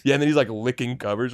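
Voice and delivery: ordinary talk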